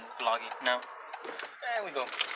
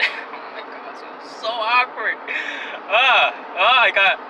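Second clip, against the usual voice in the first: cringing laugh; 5 semitones higher, 4 dB louder than usual. Real, not acted. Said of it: "that awkward sense again".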